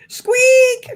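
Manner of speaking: shrill squeal cut with a laugh; high squeak. Pretend. Reading a funny chat comment.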